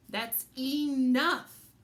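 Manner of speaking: slow, loud stern father; stern warning. A narrator's reading. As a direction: drag it out, firm and warning. Dad scolding again.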